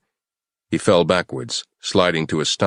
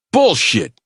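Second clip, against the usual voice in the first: angry shout; 12 semitones higher, 6 dB louder than usual. Acted. Yaya yells at captive.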